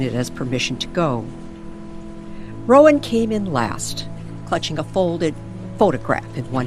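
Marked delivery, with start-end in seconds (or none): none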